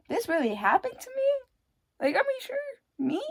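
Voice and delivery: disbelief. Real, not acted. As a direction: ask it high, incredulous. Disbelief at own past.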